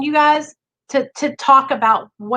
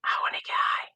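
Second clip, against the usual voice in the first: a whisper, a bit stronger; louder inner whisper; 10 dB quieter, faster than usual. Retold or imitated. Recovery talk, the craving whispers a little louder, still whispered. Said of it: "the voice gets a little louder the next day".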